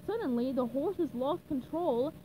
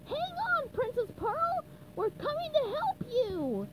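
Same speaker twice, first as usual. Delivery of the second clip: yelling; 12 semitones higher than usual. Acted. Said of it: "yelled SpongeBob".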